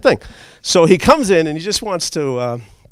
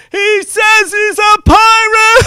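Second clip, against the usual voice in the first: high, loud, slow drawn-out shout; panicked child yell; 8 dB louder, much slower than usual. Retold or imitated. Little boy shouting to the chief about the pirate. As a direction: yell it running off in alarm.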